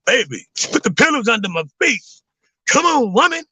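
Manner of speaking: high, needy demand rising to a whine; demanding to the wife. Retold or imitated. Acting out bossing the wife, pitched high and whiny. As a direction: whine at her for pillows. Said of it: "I around this boy".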